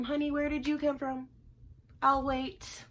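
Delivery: high sassy comeback; sassy retort. Retold or imitated. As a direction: drip with attitude. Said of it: "had the nerve to say".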